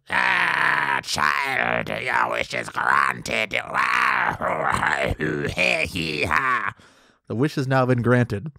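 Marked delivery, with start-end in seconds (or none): 0.1-3.5 put-on wise old comb. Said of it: "And the comb goes". 3.6-6.7 deep booming villain laugh; deep mock-evil laughter; low evil laugh. The comb granting the wish.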